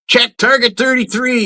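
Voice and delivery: high-pitched imitation of calling a target; range call-out.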